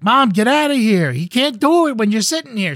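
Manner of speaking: high, loud acted protest; exasperated daughter. Daughter shoos her mother from the tent, exasperated. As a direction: whine at Mom to clear out.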